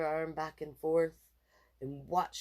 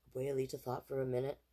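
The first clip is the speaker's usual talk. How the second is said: ordinary delivery, nothing marked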